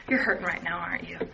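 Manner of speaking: put-on calm, soft reassurance to a scared child; gentle comfort. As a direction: kneel down and soothe a hurting child.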